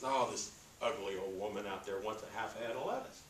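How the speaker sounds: soft, grumbling aside; grumbling manager. Retold or imitated. Produce manager grumbles to butcher about customer, soft grumble.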